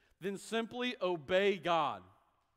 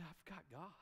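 quiet conviction; 2 semitones lower, 13 dB quieter than usual. Pretend. Preacher voicing confident believer.

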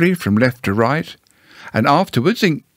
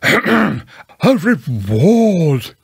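raised, drawn-out emphatic owl voice; very loud; 5 semitones higher, much slower than usual. Acted. Owl corrects Pooh after being misheard, very loudly. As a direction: belt out REWARD, stretching it. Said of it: "said Owl very loudly".

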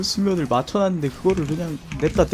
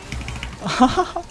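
high breathy laugh; 11 semitones higher than usual. Real, not acted. Laugh at team wipe.

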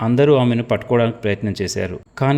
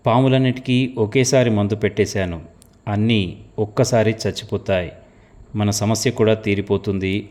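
ordinary delivery, nothing marked

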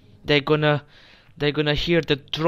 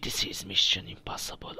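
whispering; 6 semitones lower, 6 dB quieter than usual. Real, not acted. Sneaking past laser grid.

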